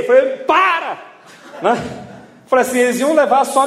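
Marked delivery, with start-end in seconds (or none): 0.4-1.0 high, mild mock yell; retold yell. Injured man to rescuers pulling his arms, retold yell. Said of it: "Eu gritei".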